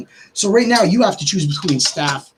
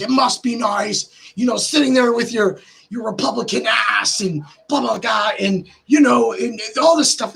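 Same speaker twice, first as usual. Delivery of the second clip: mock online rant; 7 semitones higher than usual. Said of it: "go off and be like".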